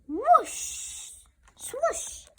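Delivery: high airy whooshing; high windy whoosh. Acted. Child reads the wind noises, high and airy. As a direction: blow it like wind.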